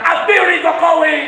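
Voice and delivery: imitating students' high rally cry; high, forceful demonstrator's yell; protest shout. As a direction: shout the slogan like a demonstrating crowd. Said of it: "Rakyat mahasiswa di mana-mana teriak".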